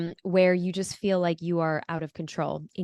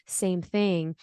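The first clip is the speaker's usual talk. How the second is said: usual speaking voice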